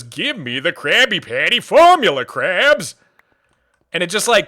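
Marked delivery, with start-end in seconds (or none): 0.0-2.9 Plankton voice. Acted. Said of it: "Plankton impression".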